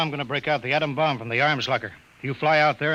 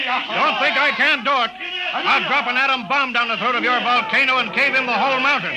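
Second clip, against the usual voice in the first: raised, furious threat; angry threat; 7 semitones higher, 4 dB louder than usual. Acted. Colville threatening the Potter with an atom bomb, angry raised voice.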